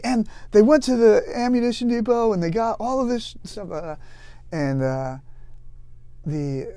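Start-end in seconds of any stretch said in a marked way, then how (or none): 0.0-3.7 high, loud indignant report; tattling lieutenant. Retold or imitated. Lieutenant reporting the corporal to the CO, indignant high complaint. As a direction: rattle off the offences like a tattletale. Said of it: "he's like".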